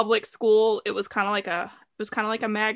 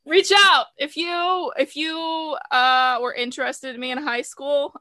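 high, loud playful call-out; mock public appeal; 5 semitones higher, 4 dB louder, slower than usual. Host to high school admirers, playfully calling out high. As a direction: call out high to whoever might be listening.

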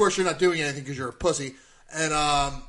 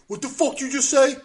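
slow, high mock challenge; acted confrontational demand; indignant challenge; 9 semitones higher, 4 dB louder than usual. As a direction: imitate an affronted fan squaring up. Retold Cowboys fan challenges him.

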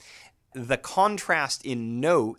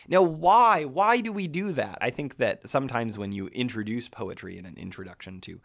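normal talking voice

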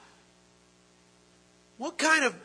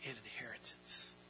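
whispered; 8 semitones lower, 9 dB quieter than usual. Acted. Hushed climax of the verse.

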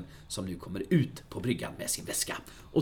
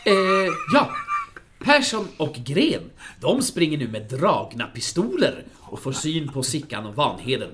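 ordinary talk

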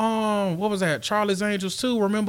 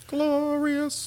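high, soft drawn-out echo of the word; playful high echo; 10 semitones higher, 6 dB quieter than usual. Pretend. Co-host echoing the host's "glorious", playful high echo. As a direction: echo it high and softly, holding the note.

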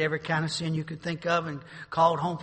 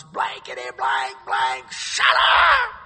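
mock angry retort; 2 semitones lower, 11 dB louder, much slower than usual. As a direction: bark him off in disgust. Drunk neighbor cursing back.

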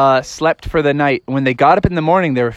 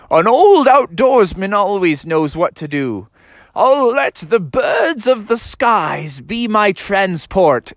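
higher, drawn-out proclamation; grand outdoorsman; 6 semitones higher, slower than usual. Acted. Froggy's dad summons the birds, slow grand proclamation. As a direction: declaim like a self-styled wilderness master.

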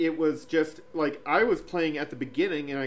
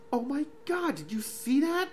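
astonished; 10 semitones higher than usual. Retold or imitated. Retelling astonishment at the dog.

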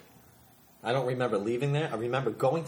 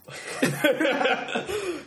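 loud high laugh; 13 semitones higher, 4 dB louder than usual. Real, not acted. Laughing at the banter.

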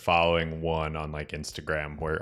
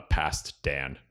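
usual speaking voice

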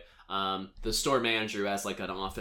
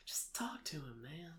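soft whispered plea; exasperated whisper; 4 semitones higher, 15 dB quieter than usual. Real, not acted. Podcaster, worked up about the movie, whispers in exasperation. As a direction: breathe it out in frustration. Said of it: "I'm so worked up".

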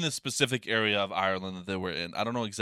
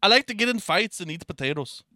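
much higher, hammed-up Irish lilt; Irish accent; 10 semitones higher, 3 dB louder than usual. Shown as a demonstration. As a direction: put on a playful Irish brogue. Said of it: "one of my accents I can do pretty good".

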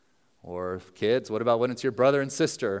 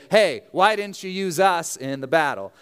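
louder, higher indignant challenge; mock complaining; 5 semitones higher, 5 dB louder than usual. Ephraim comes up to grieving Jephthah, mock complaining. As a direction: confront him with a loud gripe. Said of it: "They must be chronic complainers".

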